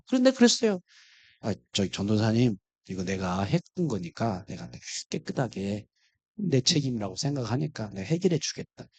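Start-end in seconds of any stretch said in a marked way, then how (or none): 1.4-8.9 much softer and lower, meek and yielding; humble, like a sinner. Retold or imitated. Pastor humbly offering to pay the evangelist, soft and low. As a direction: speak low and meek, like a guilty man. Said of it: "아주 공고분하게 죄인처럼".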